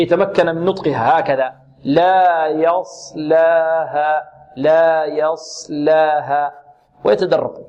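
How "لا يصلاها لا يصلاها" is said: very slow, drawn-out يصلاها; slow letter-by-letter. Shown as a demonstration. Tajweed teacher coaching beginners, slow deliberate لا يصلاها. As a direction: spell out يصلاها sound by sound for a beginner. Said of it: "يعني يقرأ ببطء".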